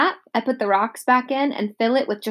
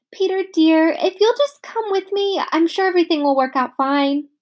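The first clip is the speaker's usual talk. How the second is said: coaxing nurse; 8 semitones higher than usual. A narrator's reading. Nurse coaxes Peter sweetly.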